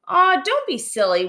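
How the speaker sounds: plain reading voice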